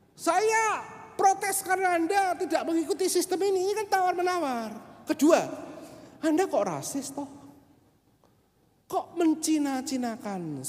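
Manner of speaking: high, hammed-up lecture voice; mock indignant protest. Preacher's mock lecture to the driver, hammed-up and high.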